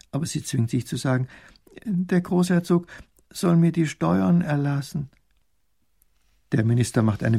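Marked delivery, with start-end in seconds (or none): 1.8-5.1 high, halting, forced-out request; frightened. A narrator's reading. Poor woman asks the minister for tax relief, scared. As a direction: force it out timidly. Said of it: "kriegt vor Angst kaum etwas heraus".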